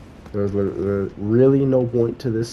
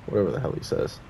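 normal talking voice

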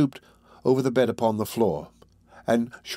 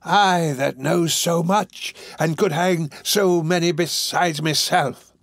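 high, drawn-out agitated cry; near yell; 6 semitones higher, slower than usual. A narrator's reading. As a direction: stretch it out, worked up. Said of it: "almost in a yell".

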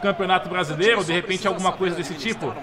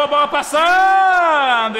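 loud, high, drawn-out commentator call; excited near-miss call; 8 semitones higher, 8 dB louder, much slower than usual. Acted. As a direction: shout the chance then groan. Near miss while playing.

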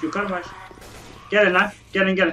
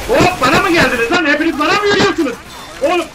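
high, loud, fast alarm; alarmed outburst; 7 semitones higher, 4 dB louder than usual. Real, not acted. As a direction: panicky shout at the horde. Zombies charging at him. (tiz ve yüksek sesli panik)

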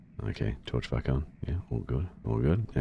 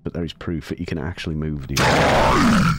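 louder, rising to a near shout at the end; excited outburst; 4 semitones higher, 9 dB louder than usual. Real, not acted. Streamer proving to viewers the iron moves, loud and excited. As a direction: blurt the proof out triumphantly.

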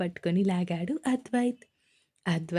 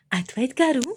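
sweetly calling out "Advait గారు," with a lilt on గారు; lightly voiced cute plea; cute coaxing; 3 semitones higher than usual. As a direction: coax him like a playful bride.

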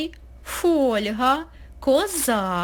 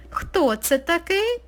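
usual speaking voice

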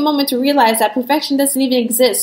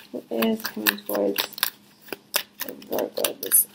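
asked again much softer, almost to herself; hushed repeat; 12 dB quieter than usual. Shown as a demonstration. Tarot reader softly asks the cards again, much softer. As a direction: drop right down and murmur the question.

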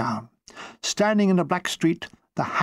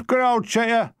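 Cockney heckle; 6 semitones higher than usual. Acted. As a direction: shout it like a Cockney gunner. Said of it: "Cockney witticisms".

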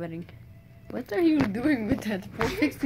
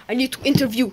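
said out loud and forceful; loud announcement; 3 semitones higher, 7 dB louder, much faster than usual. Pretend. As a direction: announce it loudly to the others. Same words repeated, louder take.

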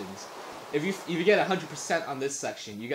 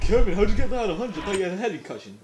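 higher, louder imitated questioning; mock challenge; 7 semitones higher, 8 dB louder, faster than usual. Retelling a kid questioning Kevin's score, mock challenge. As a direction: imitate someone calling you out.

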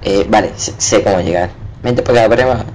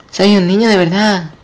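put-on high childish voice; mock child voice; 10 semitones higher than usual. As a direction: squeak it like a little kid. Mimics character line.

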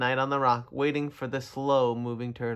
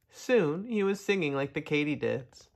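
ordinary delivery, nothing marked

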